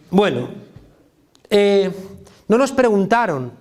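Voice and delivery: usual speaking voice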